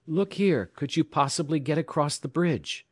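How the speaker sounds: high, pressing request; urgent plea. A narrator's reading. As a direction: ask with urgent insistence. Urgent telegraph plea in emergency.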